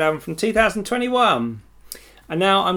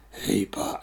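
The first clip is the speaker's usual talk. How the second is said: a lightly voiced low breathy greeting; 9 semitones lower, 5 dB quieter than usual. Host trying another deep [name] impression on air. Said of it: "How do you do it?".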